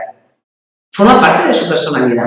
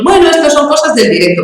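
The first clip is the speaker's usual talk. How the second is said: mock excuse; 9 semitones higher than usual. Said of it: "aunque decimos".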